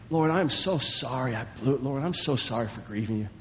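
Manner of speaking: low, soft, contrite prayer; sincere prayer. Pretend. Preacher models how a loved son prays, soft and sincere. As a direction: confess gently to God. Voicing a heartfelt prayer of repentance.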